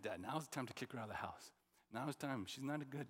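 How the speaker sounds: playing earnest, low and quiet; mock serious. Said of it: "said it in a serious voice".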